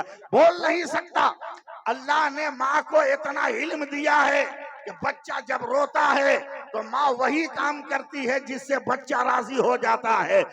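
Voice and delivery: high, loud, rising oratory; full-force preaching. Acted. Preacher to the Kishanganj gathering, full-force preaching. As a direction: thunder it to the crowd.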